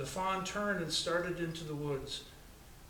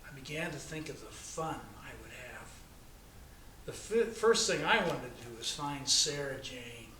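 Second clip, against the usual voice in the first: plain reading voice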